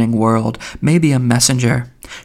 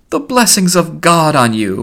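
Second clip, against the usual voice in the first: raised, higher-pitched hearty cry; 5 semitones higher, 3 dB louder than usual. A narrator's reading. Mulligan blessing Haynes for milk news, crying out. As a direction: call out a blessing. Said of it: "Buck Mulligan cried".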